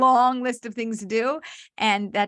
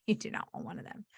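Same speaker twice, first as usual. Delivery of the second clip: whispered aside; 10 dB quieter, faster than usual. Real, not acted. Conspiratorial aside to the listeners.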